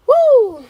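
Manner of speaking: high, loud "woo"; joyful whoop. Real, not acted. Vlogger overcome by her own testimony, whooping. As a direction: let out a real, overwhelmed whoop. Overwhelmed whoop after laughing.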